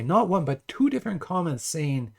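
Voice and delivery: usual speaking voice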